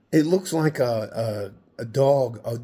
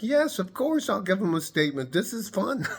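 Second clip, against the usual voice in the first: sarcastic eager reply; 8 semitones higher than usual. Retold or imitated. Sarcastic retold answer to police.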